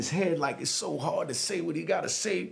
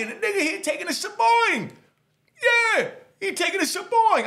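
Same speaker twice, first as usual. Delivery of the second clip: outraged disbelief; 14 semitones higher, 4 dB louder than usual. Retold or imitated. Outraged exclamation.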